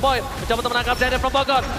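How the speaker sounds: normal talking voice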